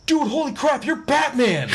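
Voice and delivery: high, loud imitation of an amazed fan; starstruck outburst. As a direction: squeal it out like an astonished young cab driver. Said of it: "he goes like this".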